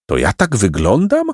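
usual speaking voice

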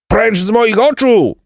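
very high, slow, drawn-out shout; furious bellow. A narrator's reading. Captain dismisses the dozing bandit, bellowing in his ear. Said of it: "huknął mu nad uchem kapitan".

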